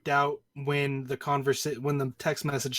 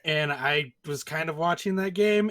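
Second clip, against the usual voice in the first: ordinary talk, nothing marked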